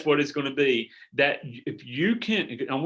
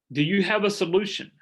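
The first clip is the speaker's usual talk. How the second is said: ordinary talk, nothing marked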